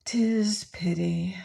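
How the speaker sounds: slow, sighed regret on 'Tis; sighing. A narrator's reading. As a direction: let 'Tis pity sink in a sigh. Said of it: "she sighed".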